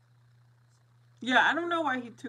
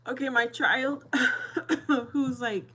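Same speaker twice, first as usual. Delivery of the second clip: laughing; 5 semitones higher, 9 dB louder than usual. Real, not acted. Laughing while talking about son.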